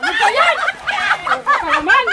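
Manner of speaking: loud, high, laughing yell; laughing shout. Real, not acted. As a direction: shout while cracking up. Just got soaked, laughing.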